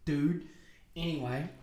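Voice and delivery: ordinary talk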